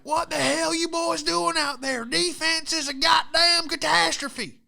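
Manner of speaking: high, loud furious coach yelling at his team; angry coach. Retold or imitated. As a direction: chew out the boys at halftime. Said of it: "my high school football coach".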